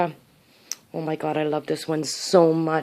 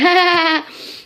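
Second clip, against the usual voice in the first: loud, high burst of glee; excited squeal; 10 semitones higher, 8 dB louder than usual. Real, not acted. Grandma packing her handmade outfit, excited squeal. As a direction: squeal loudly with delight. Thrilled by the outfit she made.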